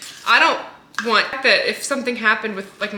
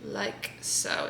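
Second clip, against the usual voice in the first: whispering; 2 semitones lower, 14 dB quieter than usual. Real, not acted. Soft aside while pouring.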